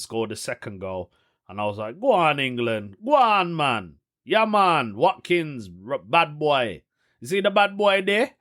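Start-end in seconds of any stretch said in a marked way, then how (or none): 2.0-8.3 cheering in London street slang; mock roadman cheer for England. Cheering England's scorer in London street slang. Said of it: "I don't know why I'm speaking like that".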